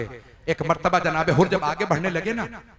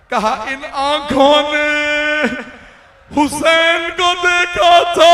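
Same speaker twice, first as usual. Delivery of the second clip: much higher, much louder, very slow anguished wail; wailing climax; 10 semitones higher, 9 dB louder, slower than usual. Acted. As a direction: let it rise into a sustained keening cry. Climactic wail of Hur's guilt.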